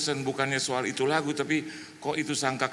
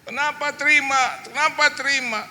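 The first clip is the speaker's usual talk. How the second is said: high, loud accusing wail; retold sibling outburst; blaming; 13 semitones higher, 6 dB louder than usual. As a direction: scold your brother at the top of your range. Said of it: "Adik saya menyalahkan saya".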